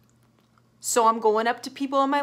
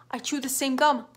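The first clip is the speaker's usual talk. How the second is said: usual speaking voice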